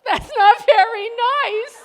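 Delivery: laugh-cry at the hurt; laughing protest. Real, not acted. Hurt by Jesus' remark.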